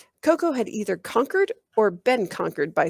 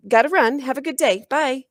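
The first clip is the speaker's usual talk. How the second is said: higher and louder, rushing out the words; hasty goodbye; 7 semitones higher, 6 dB louder than usual. Acted. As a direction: blurt it out and escape. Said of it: "Hastily, she added".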